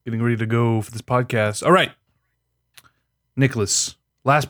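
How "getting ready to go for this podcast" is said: talking between sips; words around a sip of drink; while sipping a drink. Real, not acted. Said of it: "I'm sipping on my".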